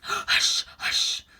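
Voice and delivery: urgent whispered hush; low hurried hush. Acted. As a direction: shush her fast. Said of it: "in a low, hurried tone".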